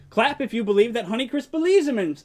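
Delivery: usual speaking voice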